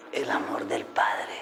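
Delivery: softly whispered, slow and awed. Real, not acted. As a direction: breathe it out in wonder. Awed aside about the Father.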